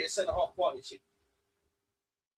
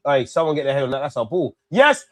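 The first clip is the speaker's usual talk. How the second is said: normal talking voice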